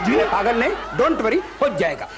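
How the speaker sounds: usual speaking voice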